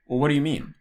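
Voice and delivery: "what do you mean" with a questioning lift, falling away at the end; questioning intonation. Shown as a demonstration. Giving an example of a question's intonation pattern, questioning tune. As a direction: ask "what do you mean", pitch up then dropping. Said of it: "intonation patterns related to asking a question".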